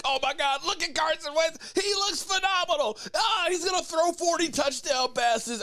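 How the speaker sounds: high, giddy imitation of a gushing fan; sing-song parody of an overexcited Eagles fan; mocking gushing fan. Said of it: "he's like".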